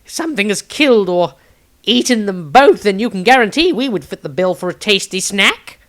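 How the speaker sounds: a panicky fretful wizard; panicked. A narrator's reading. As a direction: Maestro worrying they'd be eaten, panicky and high. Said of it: "expression became panicked".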